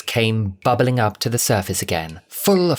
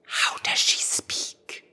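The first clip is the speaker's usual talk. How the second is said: urgent secret whisper; hissed whisper; 6 dB quieter, slower than usual. A narrator's reading. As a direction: hiss it so the caller can't hear. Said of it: "Cassandra hissed".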